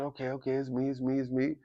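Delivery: hushed, resigned repetition; subdued acceptance. Retold or imitated. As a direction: say it small, almost to yourself. Retold resigned acceptance to officers.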